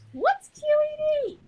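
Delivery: very high, drawn-out mock question; exaggerated high-pitched silly voice; playful echoed question. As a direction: squeak the question out slowly and loudly.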